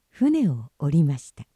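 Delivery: usual speaking voice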